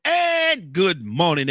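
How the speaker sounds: very slow, drawn-out "and good morning"; stretched greeting. Real, not acted. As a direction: pull each word long and bright.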